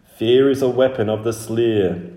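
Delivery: twining spirit voices. Acted. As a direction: proclaim it as ancient guardians. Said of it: "The twining voices sounded".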